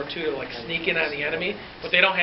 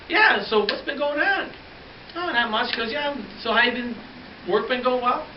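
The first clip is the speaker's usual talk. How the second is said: sly officer; 7 semitones higher than usual. Retold or imitated. Officer fishing while dad lies.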